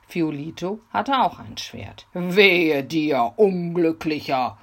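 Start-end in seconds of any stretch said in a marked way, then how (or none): 2.1-4.6 loud hoarse threat; hoarse robber voice. Acted. Robber threatening Peter in a hoarse robber voice. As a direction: snarl it as a bandit. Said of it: "mit seiner heiseren Räuberstimme an".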